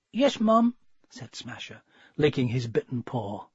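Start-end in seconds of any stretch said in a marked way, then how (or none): 0.1-0.7 put-on higher meek young dog voice; lightly voiced meek pup; puppy's voice. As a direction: answer like a scolded pup. Puppy answers mother.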